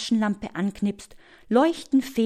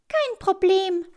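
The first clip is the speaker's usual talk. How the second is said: very high little bird voice; chirpy, squeaky hummingbird; peeping hummingbird; 11 semitones higher than usual. A narrator's reading. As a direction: pipe up like a tiny bird. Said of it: "piepst der Kolibri".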